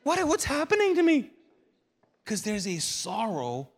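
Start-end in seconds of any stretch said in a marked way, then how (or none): 0.0-1.3 high-pitched, baffled mock wail; mock bewilderment. Preacher acting out his own confused crying, mock bewildered. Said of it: "It's like".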